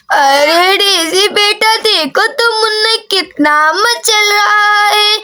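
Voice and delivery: put-on slow, drawn-out old granny; granny greeting her grandchild, slowly; doting grandmother. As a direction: stretch the words like a grandmother. Grandmother speaks to Daisy.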